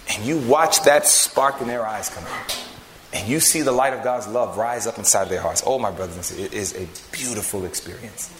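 low, reverent, lingering delivery; hushed, heartfelt pulpit reflection; tender preaching. Acted. Preacher dwells on beautiful experience.

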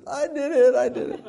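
put-on high, loud childish cracking under pressure; mock wailed confession. Spanked boy breaks and confesses, mock high wail. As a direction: give in with a squealed wail. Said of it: "you break under torture".

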